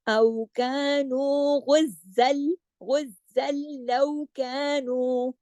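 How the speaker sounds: slow chanted recitation linking गुज़्ज़ल into लौ Kanu; joined recitation. Shown as a demonstration. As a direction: chant slowly, joining the words. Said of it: "अगर हम मिला के पढ़ेंगे".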